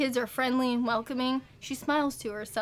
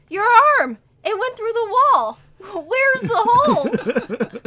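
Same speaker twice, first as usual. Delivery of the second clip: high shocked scream, much louder; 9 semitones higher, 10 dB louder, slower than usual. Acted. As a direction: shriek it in shock. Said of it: "Emma screamed".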